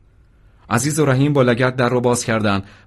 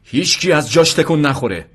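higher, raised-voice order; shouted command; 6 semitones higher, 4 dB louder than usual. A narrator's reading. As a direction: call out to freeze the room. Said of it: "مجید فریاد کشید". (فریادِ آمرانه)

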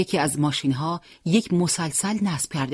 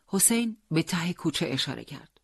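ordinary delivery, nothing marked

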